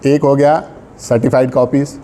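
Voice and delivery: ordinary delivery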